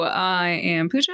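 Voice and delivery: normal talking voice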